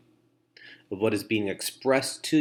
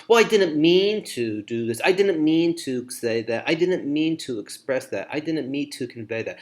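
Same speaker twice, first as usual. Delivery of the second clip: higher, louder start, easing back down; mock self-explaining; 3 semitones higher, 4 dB louder than usual. Poor listener explaining their own situation, mock defensive. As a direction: act out a run of excuses. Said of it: "explaining your situation".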